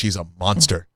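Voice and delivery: mock outrage. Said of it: "Oh my gosh".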